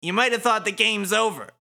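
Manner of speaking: high, loud, swooping taunt; gloating villain. Acted. Suzukichi taunting the Wicked Twisters, gloating. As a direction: crow it theatrically.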